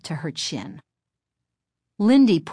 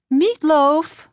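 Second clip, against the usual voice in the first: mom voice; 10 semitones higher, 6 dB louder than usual. A narrator's reading. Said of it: "Missus Powell replied".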